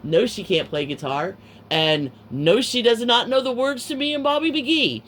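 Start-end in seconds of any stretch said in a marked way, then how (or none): 1.7-5.0 high, loud exasperated no; exasperated outburst. Retold or imitated. Storyteller on Sherry not knowing the words, exasperated outburst. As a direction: climb into a raised, incredulous protest. Exasperated verdict on her failed audition.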